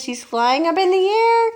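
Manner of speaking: loud, rising astonished cry. A narrator's reading. Watching grandma shoot upward, loud astonishment. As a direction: let the pitch climb as she climbs.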